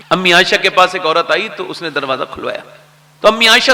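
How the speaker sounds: usual speaking voice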